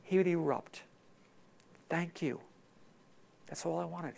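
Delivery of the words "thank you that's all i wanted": softer, grateful, gentle; tender gratitude. Retold or imitated. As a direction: thank them like a relieved father. God voiced, tender.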